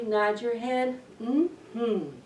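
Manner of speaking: plain reading voice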